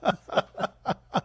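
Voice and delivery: high burst of laughter; laughing. Real, not acted. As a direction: laugh out at the clip. Laughs at the clip.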